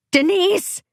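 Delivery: high, contained scream of a name. A narrator's reading. Parent calling to kidnapped daughter, screaming. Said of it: "I scream".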